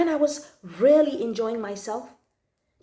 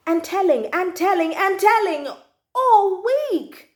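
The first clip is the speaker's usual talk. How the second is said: rising, louder and higher to the end; a cross child winding herself up; 5 semitones higher, 5 dB louder than usual. Acted. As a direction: build the annoyance to a peak. Child growing cross at fibs.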